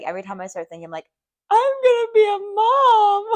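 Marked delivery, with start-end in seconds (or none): 1.5-3.4 high, loud tearful wail breaking into a laugh; sobbing. Retold or imitated. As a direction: cry it out like happy tears overflow. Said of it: "I've been sobbing".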